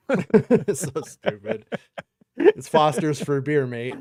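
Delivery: high and loud through laughter; laughing. Real, not acted. To his cohost, laughing at the bad Aussie accent. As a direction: laugh it out, mocking the fake Aussie.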